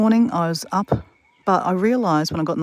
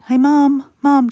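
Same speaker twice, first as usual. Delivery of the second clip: higher, louder call to Mum; retold yell; 8 semitones higher, 8 dB louder, much slower than usual. Calling Mum from outside her door, retold yell. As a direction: imitate yelling for your mum. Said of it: "But I'm yelling".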